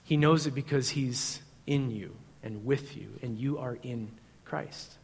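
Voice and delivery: usual speaking voice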